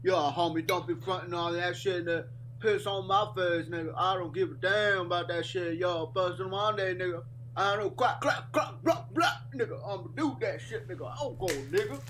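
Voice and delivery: high, loud hammed-up mock rapper. Mocking his friend's rap, high and loud. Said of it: "that's what you sound like".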